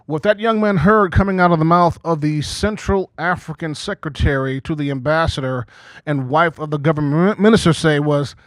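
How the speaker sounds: ordinary delivery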